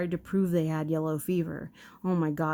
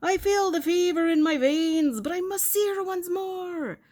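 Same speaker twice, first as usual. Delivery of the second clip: high, loud, put-on bad Irish accent; 12 semitones higher, 4 dB louder than usual. Dying husband gasps to Dolly's mother in bad Irish accent. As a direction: ham up a Lucky Charms-style Irish accent. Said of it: "a bad Irish accent".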